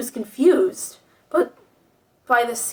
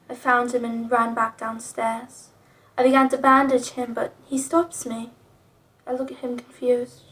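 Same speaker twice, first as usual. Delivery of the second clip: ordinary delivery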